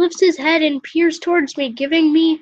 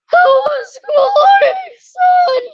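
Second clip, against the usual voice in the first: much higher, loud cartoon mom voice; shrill-pitched doting mother, loud; mother's voice; 13 semitones higher, 8 dB louder, slower than usual. Mother greeting her son home from school, high and loud. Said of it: "Mother ... greets me".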